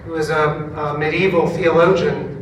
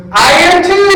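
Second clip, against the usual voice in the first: much higher, loud gleeful cry; excited exclaim; 13 semitones higher, 10 dB louder than usual. Retold or imitated. Fellow pastor telling him she is one too, excited and loud. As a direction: burst out with delight at the match. Woman also an ELCA pastor.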